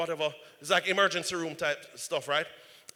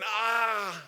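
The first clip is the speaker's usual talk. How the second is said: pained shout; 6 semitones higher than usual. Retold or imitated. Burning, crying out in pain.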